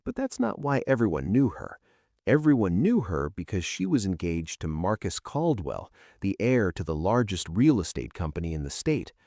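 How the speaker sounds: usual speaking voice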